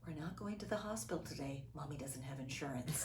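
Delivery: soft, fast mutter to herself; hushed under breath. Retold or imitated. Mother lifting child from tree, muttering quietly. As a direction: say it low, half to yourself, straining. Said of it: "and I'm like".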